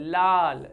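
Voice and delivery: लाल drawn out slowly, air round the tongue sides; लाल, slow lateral l; slow l demo. Shown as a demonstration. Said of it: "हवा जीभ के पास या बगल से निकलती".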